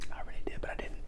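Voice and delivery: breathy whispered confession; whispered aside. Pretend. Admitting the fake apology was meant, whisper. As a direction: whisper it to the camera.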